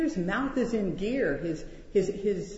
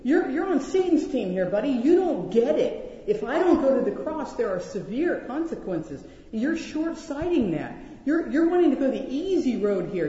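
Jesus rebuking Peter; 5 semitones higher than usual. Pretend. Jesus telling Peter he is on Satan's team, a forceful rebuke. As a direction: scold Peter hard, man to man. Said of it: "He's saying".

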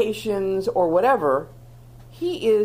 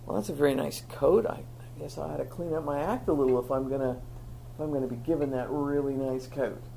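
softer, faster inner thought; musing to oneself; 5 semitones lower, 7 dB quieter, faster than usual. Pretend. Someone given respect thinking it over to himself, soft and quick. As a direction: mumble it like thinking aloud.

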